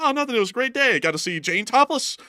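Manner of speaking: high, cheerful mock Kramer; gleeful high put-on voice; hammed-up Kramer bragging. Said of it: "Kramer's like".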